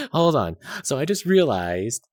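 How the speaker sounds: loud excited sudden realization. Real, not acted. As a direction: burst out with a realization.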